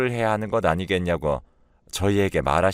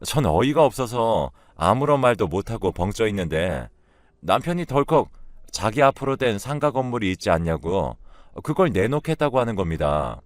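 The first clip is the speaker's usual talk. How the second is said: ordinary delivery, nothing marked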